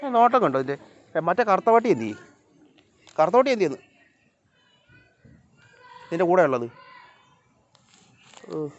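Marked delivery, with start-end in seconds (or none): 0.0-6.7 high, raised call; calling to dogs. Real, not acted. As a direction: tease the dogs from afar, pitched up.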